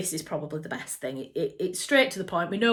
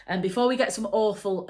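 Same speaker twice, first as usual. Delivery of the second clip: normal talking voice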